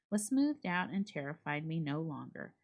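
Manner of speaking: usual speaking voice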